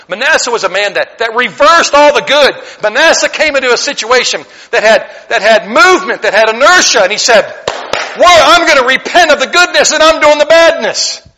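high, loud fire-and-brimstone preaching; preaching at full force. Acted. Preacher to his congregation, loud and fervent. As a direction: thunder it from the pulpit. Fiery preaching about Manasseh.

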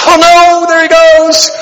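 hammed-up fear of dropping baby; mock panic. Imitating panicked younger self.

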